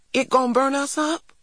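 put-on high-pitched child's worried question; anxious little boy. A narrator's reading. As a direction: ask it high, like a scared child. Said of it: "said Christopher John".

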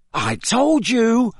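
high, drawn-out scolding exclamation; an exasperated told-you-so, pitched high; urgent hiss. A narrator's reading. Ron rebukes Hermione over the article, hissed urgently. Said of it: "Ron hissed at Hermione".

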